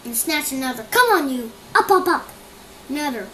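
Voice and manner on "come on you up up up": loud high yells, building; yelling. Acted. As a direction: bark at the leaves to get up. Camilla yelling at leaves.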